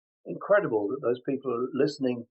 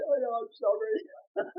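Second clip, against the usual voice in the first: sheepish fan apology; 10 semitones higher, slower than usual. Retold or imitated. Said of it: "then they laugh. They say".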